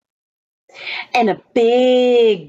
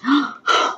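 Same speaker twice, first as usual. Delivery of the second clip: gasp; 4 semitones higher than usual. Acted. Startled at troll.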